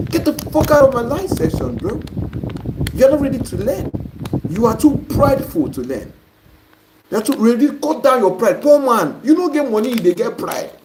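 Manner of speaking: high-pitched, exasperated telling-off; real indignant rebuke; angry scolding. Real, not acted. Host scolds a proud participant.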